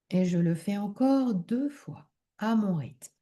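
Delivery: calm relaxation leading, unhurried and softened; guided meditation. Acted. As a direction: guide them gently into deep rest, phrase by phrase. Leads a yoga nidra relaxation.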